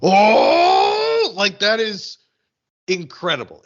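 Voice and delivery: high, loud drawn-out gasp of awe; amazed outburst. Retold or imitated. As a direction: relive a first-time thrill, starting high and loud. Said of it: "just being like".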